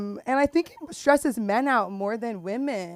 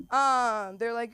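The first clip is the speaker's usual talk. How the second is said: ordinary talk, nothing marked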